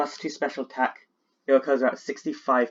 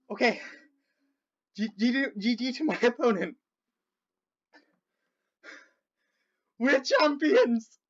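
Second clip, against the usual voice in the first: high, breathless happy voice; teary joy; 10 semitones higher than usual. Real, not acted. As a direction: still choked up from winning. Winding down after the win.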